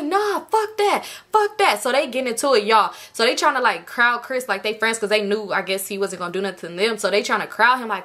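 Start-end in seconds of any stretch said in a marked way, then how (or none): 0.0-1.8 high, heated brush-off; hammed-up high-pitched defiance; mock defiant refusal. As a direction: imitate a worked-up man refusing to back down. Chris refusing to calm down.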